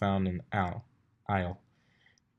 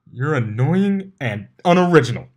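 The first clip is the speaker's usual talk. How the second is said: louder, higher sneer; 5 semitones higher, 9 dB louder than usual. A narrator's reading. Pegasus sneering at the teasing mare. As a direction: spit it out with contempt. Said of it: "Blitz practically sneered".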